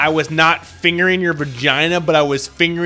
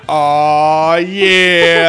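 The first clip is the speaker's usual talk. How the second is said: loud, drawn-out "Aw, yeah"; mock pleasure groan; 8 dB louder than usual. Boyfriend shows his own groan, loud mock pleasure. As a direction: ham up a satisfied groan. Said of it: "it's me going".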